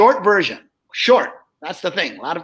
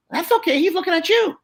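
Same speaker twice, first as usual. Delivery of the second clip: squeaky ventriloquist dummy; 10 semitones higher than usual. Retold or imitated. Dummy's punchline.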